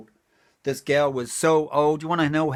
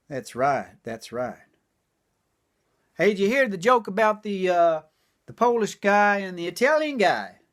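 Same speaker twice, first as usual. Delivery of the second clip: ordinary talk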